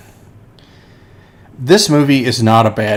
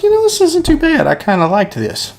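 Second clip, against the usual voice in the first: put-on teen voice. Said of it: "I was like".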